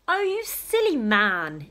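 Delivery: lightly voiced exasperated mother; a hint of a mother scolding her husband; exclaiming mother. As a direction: scold him like a fed-up wife. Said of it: "exclaimed Mother".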